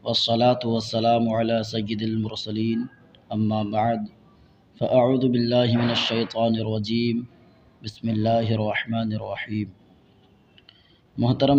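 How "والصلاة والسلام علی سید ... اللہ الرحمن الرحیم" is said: measured, low-pitched Qur'anic-style recitation; Arabic invocation. Real, not acted. Teacher opening the lesson for viewers, formal Arabic invocation.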